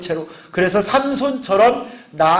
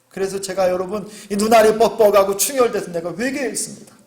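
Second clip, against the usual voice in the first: ordinary talk, nothing marked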